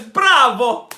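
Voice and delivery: high, loud shout of applause; hammed-up cheer. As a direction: belt out a mock-grand bravo. Acts out a viewer's cheer.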